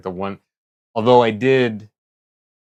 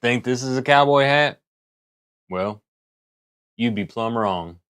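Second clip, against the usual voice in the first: put-on cowboy accent; 3 semitones higher than usual. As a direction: talk like a storytelling cowboy. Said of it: "a sexier Arthur Morgan accent".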